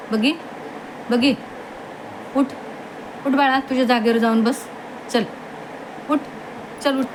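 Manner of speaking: coaxing a sleepy dog to go to its spot. Real, not acted. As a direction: sweet-talk the dog off the floor.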